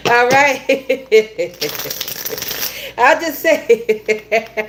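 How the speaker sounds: breaking up into laughter; words giving way to a long laugh. Real, not acted. Tarot reader cracking up at her own reading, laughing.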